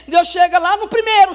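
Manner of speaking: ordinary talk, nothing marked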